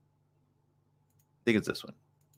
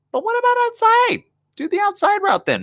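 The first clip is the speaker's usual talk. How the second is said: very high squeaky protest; mock objection; 3 dB louder than usual. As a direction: protest in a silly falsetto. Acted objection to the rules.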